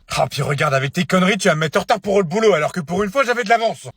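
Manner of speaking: loud, fast, high complaint; angry rant. Acted. Parent made late for work by the child, angry. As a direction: blame the child, climbing in pitch.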